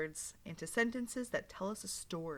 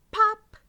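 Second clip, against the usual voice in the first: sudden high, loud pop sound; 13 semitones higher, 15 dB louder than usual. Acted. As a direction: burst out the pop like a button flying off. Button popping off in story.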